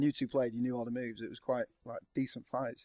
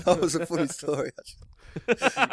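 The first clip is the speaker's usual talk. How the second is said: laughing; 4 semitones higher, 6 dB louder, slower than usual. Real, not acted. Laughing while telling.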